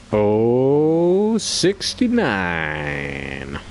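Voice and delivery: very slow drawn-out number call; bingo caller. Pretend. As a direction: stretch each word like a hall caller. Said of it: "okay job to be the bingo caller".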